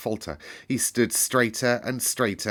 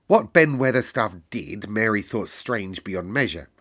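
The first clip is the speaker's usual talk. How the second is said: usual speaking voice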